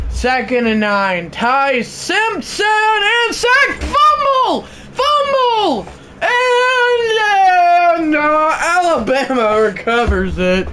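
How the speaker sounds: screaming fumble call, very high; excited play-by-play. Acted. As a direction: yell the fumble call at full pitch.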